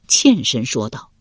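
ordinary delivery, nothing marked

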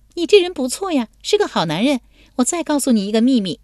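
put-on high, lively girlish voice; young woman's voice. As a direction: play the bold young lady teasing him.

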